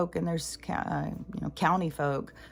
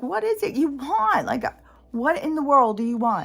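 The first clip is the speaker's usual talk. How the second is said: exasperated at dog; 7 semitones higher, 4 dB louder than usual. Retold or imitated. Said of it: "I'll be like".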